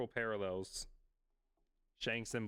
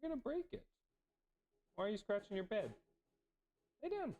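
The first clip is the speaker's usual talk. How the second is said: scolding the dog; 9 semitones higher, 4 dB quieter, faster than usual. Real, not acted.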